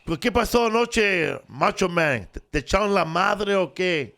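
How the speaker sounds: high, excited Spanish announcer voice; hammed-up Mexican TV interviewer; Spanish wrestling interviewer. Imitating the chubby Mexican interviewer from Channel 30. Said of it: "He'll be like this".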